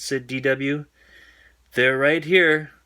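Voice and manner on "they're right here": louder, higher taunt. A narrator's reading. D W taunting Arthur about his pants. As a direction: wave it in his face.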